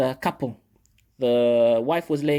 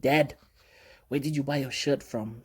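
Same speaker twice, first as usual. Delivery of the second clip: usual speaking voice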